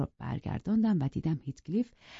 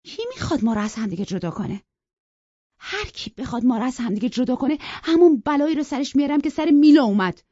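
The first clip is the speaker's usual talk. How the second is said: louder and louder furious vow; angry outburst; 8 semitones higher, 8 dB louder than usual. Acted. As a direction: rage building to a shout. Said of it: "با عصبانیت گفت".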